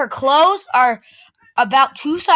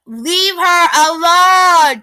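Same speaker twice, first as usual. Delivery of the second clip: slow, higher and louder; firm warning; 5 semitones higher, 5 dB louder, much slower than usual. A narrator's reading. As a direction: stand up to him. Narrator confronting the bully.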